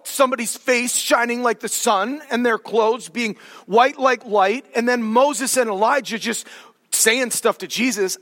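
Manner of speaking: ordinary delivery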